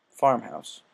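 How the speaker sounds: plain reading voice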